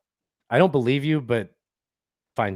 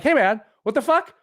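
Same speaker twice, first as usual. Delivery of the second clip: higher-pitched mock protest as the son; playful, high-pitched indignation; indignant protest; 10 semitones higher than usual. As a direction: voice a son's offended objection, pitched up. Voicing the son's indignant protest.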